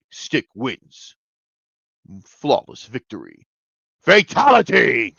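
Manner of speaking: slow, booming game announcer voice, building to a big finish; Mortal Kombat announcer. Retold or imitated. Recapping Stick's kill, he booms like a Mortal Kombat announcer. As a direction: call the win like an arcade fighting game. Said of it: "everyone probably went".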